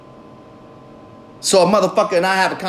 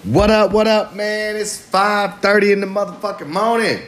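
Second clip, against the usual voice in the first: show opener; 5 semitones higher than usual. Acted. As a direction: open the show with a high, sing-out hello.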